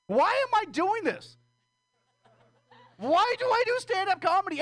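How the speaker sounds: high pleading why; exasperated outcry. Real, not acted. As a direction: throw up your hands and wail it. Exasperated rhetorical outburst.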